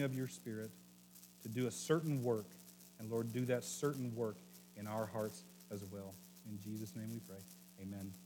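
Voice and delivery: soft, hushed pastor's prayer; quiet prayer. Acted. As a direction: pray gently, fading to near silence. Leads a prayer.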